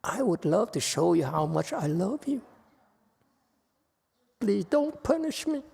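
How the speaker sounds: high, pleading submissive voice. Acted. To the master, begging in a high pleading voice. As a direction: beg sweetly not to be punished.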